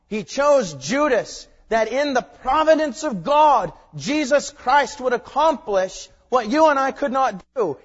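loud, high, emphatic pulpit cry; full-force preaching. Real, not acted. Preacher declaring God's providence to congregation, full-force preaching. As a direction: proclaim it with ringing force.

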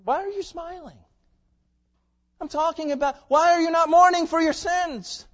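high-pitched indignant challenge, building to a shout; acted outraged preacher confronting the congregation; imitated rebuke. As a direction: scold the smilers, then let it ring out loud. Acted rebuke of smiling listeners.

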